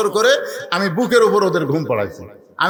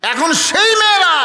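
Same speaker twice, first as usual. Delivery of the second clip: impassioned cry; 12 semitones higher, 4 dB louder than usual. Acted. Father marvelling at how his daughters are now, impassioned cry. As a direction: ring it out high over the crowd. Preacher cries out the contrast.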